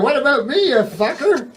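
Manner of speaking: high-pitched indignant protest; acted aggrieved outburst. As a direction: complain back, voice pitched right up. Acted complaint back at the ghost.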